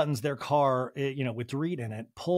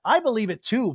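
usual speaking voice

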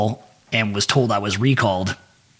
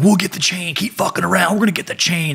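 imitating threatening instructors, higher pitch; mock threat; 6 semitones higher than usual. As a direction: raise the voice in a teasing imitation of a threat. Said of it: "That was, like, their big threat".

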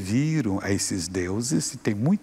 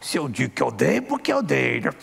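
higher put-on demon voice, defiant; defiant demon voice rising higher; angry demon; 4 semitones higher than usual. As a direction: sneer it as a cornered demon. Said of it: "o demônio lá bravo".

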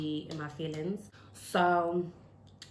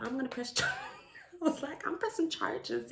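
high-pitched, breaking into laughter; laughing; 10 semitones higher than usual. Real, not acted. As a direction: laugh through the retold declaration. Breaks up laughing while retelling.

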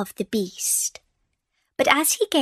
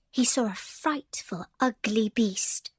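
ordinary delivery, nothing marked

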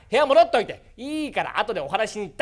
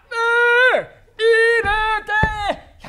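ancient grandpa; 14 semitones higher, 7 dB louder, faster than usual. Acted. 120-year-old grandpa calling.

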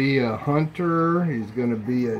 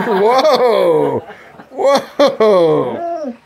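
laughing whoa; 7 semitones higher, 5 dB louder than usual. Real, not acted. Dog jumping on her.

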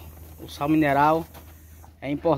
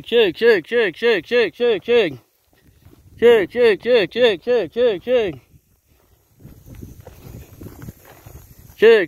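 high, loud repeated call; calling horses; 7 semitones higher, 5 dB louder than usual. Real, not acted. Farmer calling his horses to the feed, high lilting loud call.

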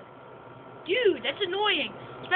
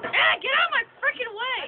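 frustrated yell; 8 semitones higher than usual. Real, not acted. Yelling at game companion.

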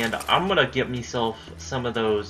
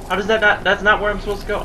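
loud, higher exasperated outburst; frustrated yell; 9 semitones higher, 6 dB louder, faster than usual. Real, not acted. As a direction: yell at the game in disbelief. Frustrated at wrong path.

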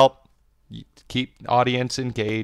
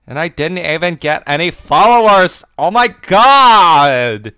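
mock exasperation; 8 semitones higher, 10 dB louder than usual. Real, not acted. Mock despair about followers.

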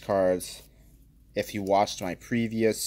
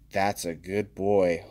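pet praise; 3 semitones lower, slower than usual. Pretend. Praising a card like a good dog, slow and cooing. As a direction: coo over the card like a pet.

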